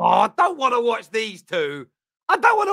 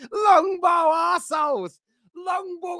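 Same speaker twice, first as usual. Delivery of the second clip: mocking echo; 8 semitones higher, slower than usual. Reactor mockingly echoes the fan.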